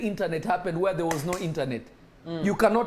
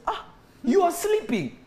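Jesus chiding; 10 semitones higher than usual. Acted. Jesus finds disciples asleep.

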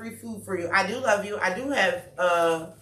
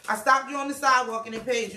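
ordinary delivery

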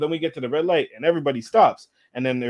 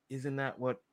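very soft, private aside; hushed silent objection, imitated; inner thought; 3 semitones lower, 14 dB quieter than usual. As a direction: think it aloud under the breath. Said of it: "In my head".

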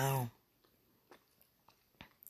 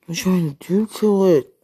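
loud, higher, emphatic question; insistent repeat; 4 semitones higher, 8 dB louder than usual. Real, not acted. Pressing a lover for an answer, loud and insistent. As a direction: push the question out loud and high.

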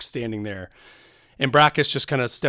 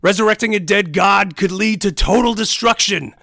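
higher, louder urgent orc voice; alarmed orc warrior; 8 semitones higher, 9 dB louder than usual. Acted. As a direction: plead against the madness. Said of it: "Brachus immediately says".

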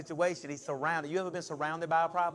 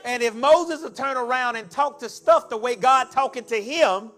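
raised, building preacher's repetition; rhythmic preaching; 6 semitones higher, 11 dB louder than usual. Real, not acted. Preacher testing the verse on the congregation, building force. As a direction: build each repeated phrase toward a loud finish.

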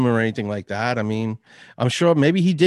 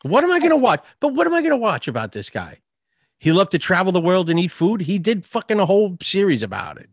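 higher-pitched, worked-up dismissal; exasperated rant; 6 semitones higher than usual. Real, not acted. Friend dismissing interest in a dead TV host, exasperated. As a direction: argue it with rising exasperation.

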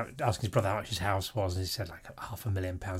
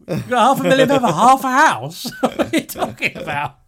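much higher, much louder, slow disbelieving yell with a laugh; incredulous outburst; 12 dB louder than usual. Retold or imitated. Northern Irish friend shouting at brother's flat price, incredulous. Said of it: "He's going".

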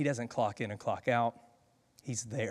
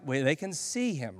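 ordinary talk

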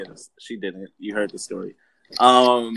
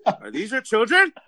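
high, mock raised-voice questioning; Lindsay Lohan; 7 semitones higher, 4 dB louder than usual. Co-host imitating the actress accosting a family, mock raised voice. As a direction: imitate her pushing the pitch up into the question. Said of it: "like, raising her voice".

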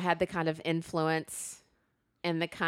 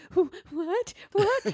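mock bewildered; 13 semitones higher, slower than usual. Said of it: "you're just like".

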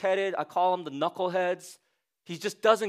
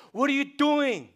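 usual speaking voice

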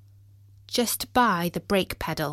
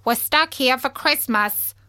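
higher, louder quacky duck voice; talking duck; 5 semitones higher, 5 dB louder than usual. A narrator's reading. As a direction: speak as a put-out duck. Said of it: "said the duck".

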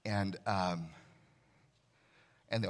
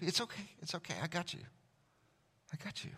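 soft, higher comforting murmur; soothing a child; 8 semitones higher, 8 dB quieter, faster than usual. Retold or imitated. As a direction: reassure like holding a scared child. God soothing like a dad.

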